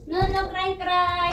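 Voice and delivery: high sing-song soothing. Real, not acted. Soothing a fussy baby. (malambing na pag-alo sa sanggol)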